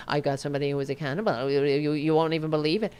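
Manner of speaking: low, rushed monotone mimicry; mock deadpan, rambling on without inflection; emotionless official imitation. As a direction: rattle it off low with no feeling. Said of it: "With no emotion".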